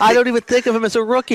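high, fast and loud; excited. Real, not acted. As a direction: blurt it out in sudden excitement. Excited realization about Kamara.

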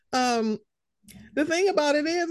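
ordinary talk, nothing marked

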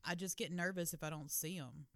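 ordinary talk, nothing marked

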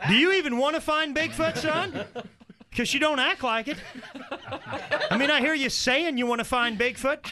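hammed-up scolding father; pushy dad. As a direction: lecture your lazy son like a coach. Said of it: "have that guy as a dad".

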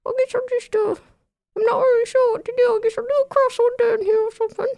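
tiny high squeaky voice. Acted. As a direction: speak like a small trapped creature. Kevin trapped inside.

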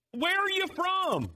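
very high, drawn-out yelled question; mock yell. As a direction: holler it slowly as if to someone dim. Joke punchline, question repeated as yell.

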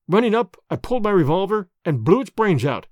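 plain reading voice

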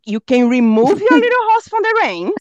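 slow, high, deliberate English, every word spelled out; mock literal translation. Translating the saying for her husband, slow and exaggerated. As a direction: read the translation slowly and deliberately.

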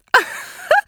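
a high, loud burst of laughter; shrill laugh. Acted. Lady laughing loudly at her own teasing.

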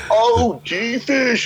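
high, drawn-out squealed amazement; hammed-up high-pitched awe; mock group excitement. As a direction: imitate the mates' giddy chorus, way up high. Mates react to the call.

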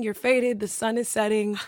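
normal talking voice

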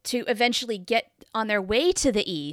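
ordinary delivery

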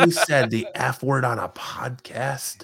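slow, scandalised whisper; mock shocked whisper. Friend teases him about cursing, in a slow mock-shocked whisper. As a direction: hush it out like a gasping churchgoer.

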